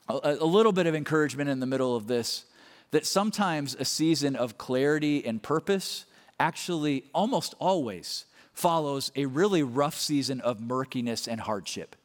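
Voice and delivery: usual speaking voice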